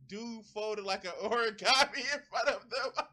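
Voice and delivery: laughing. Real, not acted. Streamer reading chat and laughing, laughing read.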